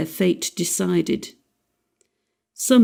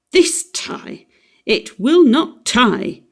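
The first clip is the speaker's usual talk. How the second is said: exasperated; 5 semitones higher, 3 dB louder, slower than usual. Acted. Father furious over his tie.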